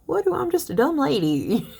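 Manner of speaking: teacher mimicking the poet's feigned humility; mock ditzy apology. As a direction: play dumb, sweetly apologetic. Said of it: "she'll be like".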